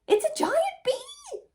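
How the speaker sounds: very high, loud panic; terrified shriek. Acted. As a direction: shriek as you run away. Bear flees in terror.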